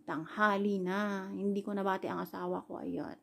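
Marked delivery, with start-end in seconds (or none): none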